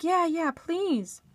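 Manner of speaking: high, slow, eager plea; pleading wife. Retold or imitated. Wife answering an offer of help, pleading.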